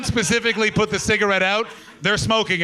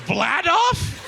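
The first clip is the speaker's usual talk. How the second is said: high, disbelieving question of the name; incredulous; 10 semitones higher than usual. Real, not acted. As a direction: repeat the name like you can't believe it. Incredulous repeat of odd name.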